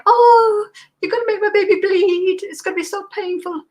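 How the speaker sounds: crying mother. Retold or imitated. Mother crying as the nurse pricks her baby's foot. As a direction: mimic a distraught mother crying over a needle. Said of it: "the mother will start crying".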